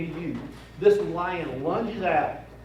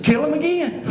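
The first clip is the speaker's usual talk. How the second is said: much higher, teasing deadpan; mock-serious order; 10 semitones higher than usual. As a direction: needle your buddy with a straight-faced order. Joking jab at Dale.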